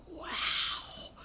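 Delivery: breathy whispered wow, to himself; awed whisper. Acted. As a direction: whisper the wow as a private thought. Micah thinks to himself, awed.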